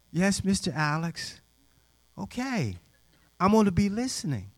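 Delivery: a hint of a compliant girl's voice; meek girl. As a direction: answer obediently, imitating a chastened girl.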